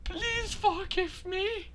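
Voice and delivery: high, soft, slow plea; mock-tearful pleading. Player apologizing to future viewers, mock-tearful plea. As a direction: whimper a hammed-up apology.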